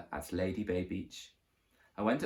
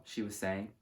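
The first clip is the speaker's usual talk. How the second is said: ordinary delivery, nothing marked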